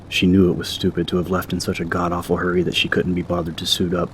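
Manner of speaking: ordinary delivery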